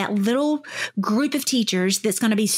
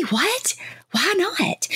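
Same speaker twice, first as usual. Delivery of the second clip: offended; 8 semitones higher, much slower than usual. Retold or imitated. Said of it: "like, so offended".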